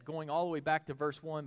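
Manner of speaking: usual speaking voice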